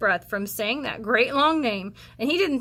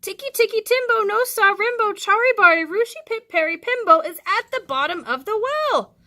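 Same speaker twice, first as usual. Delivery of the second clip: very high, loud last-breath shout; frantic child screaming the name; desperate shout; 10 semitones higher, 5 dB louder than usual. Acted. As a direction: pour the last bit of breath into it. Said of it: "he shouted".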